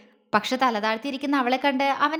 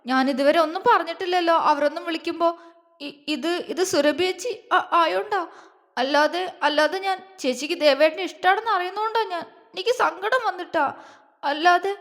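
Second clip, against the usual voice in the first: higher, halting voice near tears; a wife breaking into tears as she explains; tearful; 4 semitones higher than usual. A narrator's reading. Wife explains her jealousy to husband, tearful and halting. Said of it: "മുഖം പൊത്തി കരഞ്ഞു".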